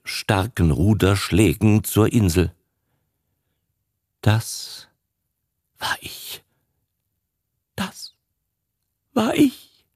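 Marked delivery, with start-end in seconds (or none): 4.2-9.9 hushed, near voiceless guilt; whispered confession. A narrator's reading. Old father confesses his guilt to his son, whispering. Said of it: "flüstert der alte Herr".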